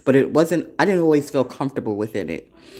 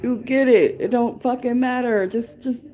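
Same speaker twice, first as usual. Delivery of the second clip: admiring cheer; 9 semitones higher, slower than usual. Acted. Admiring another woman's authenticity.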